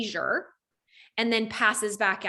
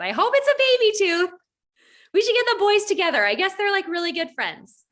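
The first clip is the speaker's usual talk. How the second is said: much higher, louder chirpy mom; sweet mom voice; 10 semitones higher, 5 dB louder than usual. Retold or imitated. Stranger mom apologizing over the knocked-out tooth, chirpy and high. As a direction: play a bubbly, over-friendly mother.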